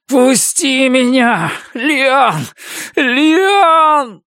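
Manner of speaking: high, slow howl rising to a scream. Acted. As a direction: wail like a wounded beast. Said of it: "Завывая, как раненый зверь".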